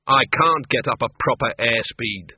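put-upon little man, drawn out; put-upon husband. Acted. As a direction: repeat it slowly as the hapless husband. Arthur echoes wife after crash landing.

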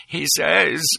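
hesitant, gulping before the words; gulping nervously. A narrator's reading. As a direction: swallow hard, scared to say it. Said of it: "he gulped".

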